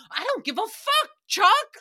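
hammed-up shout with a big loud finish on Chuck; mock argument yell. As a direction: snap it at your boyfriend like a rhyme mid-fight. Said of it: "I'm just like".

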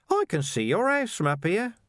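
high, grinning mockery; cheeky taunt. A narrator's reading. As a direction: tease him from above, sing-song high. Carcer taunts from the roof.

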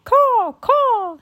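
loud, very high bird call; two drawn squawks; raven cawing. A narrator's reading. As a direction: caw out in thanks. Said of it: "he called, as if thanking her".

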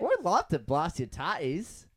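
slow Aussie repeat, tatties drawn out; Australian accent. Pretend. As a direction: copy the Australian line slowly. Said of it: "Kind of".

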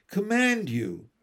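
imperious decree; commanding. A narrator's reading. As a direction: insist with a king's authority. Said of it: "the king commanded".

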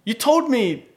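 high-pitched mock accusation; flustered, angry buyer. Buyer confronting the seller over his car, mock indignant. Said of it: "just so flustered and angry".

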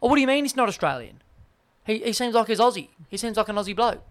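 high-pitched mock surprise; mock Aussie fan. Australians claiming Federer as one of their own, high mock protest. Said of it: "we look at Roger Federer and we go".

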